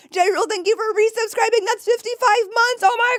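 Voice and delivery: high, loud, fast thank you; excited thanks. Real, not acted. Streamer thanks a long-time subscriber, high and excited. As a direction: gush over the milestone.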